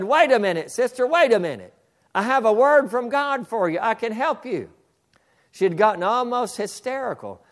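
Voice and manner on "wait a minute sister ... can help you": high, loud insistent shout; near shout. Retold or imitated. Preacher's third call to the hysterical woman, near shouting. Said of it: "The third time I said".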